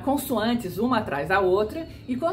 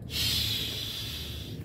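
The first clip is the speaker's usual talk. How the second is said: long soft sh hiss, smiling; hiss like a kitten; 5 dB quieter than usual. Shown as a demonstration. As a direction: hiss sh like a little cat. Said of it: "Que nem um gatinho".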